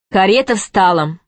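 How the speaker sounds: usual speaking voice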